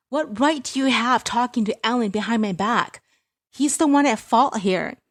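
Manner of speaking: high, loud angry accusation; angry demand. Acted. Angry daughter.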